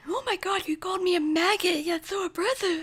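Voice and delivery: high-pitched, hammed-up outrage; mock offended recruit. Mocks an offended recruit answering sergeant.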